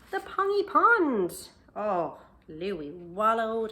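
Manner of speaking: big slow loud storybook climax; excited reveal. A narrator's reading. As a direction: shout out the big discovery. Climax of Louis's search.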